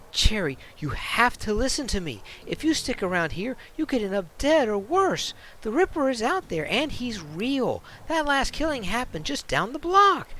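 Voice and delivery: urgent, worried man begging her to leave; pleading ex. Acted. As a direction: warn her like her life depends on it. Ex voices urgent warning.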